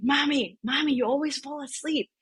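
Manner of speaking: put-on high-pitched little girl's whine; imitated complaining daughter. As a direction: imitate a child's reproach to mom. Voices her young daughter complaining.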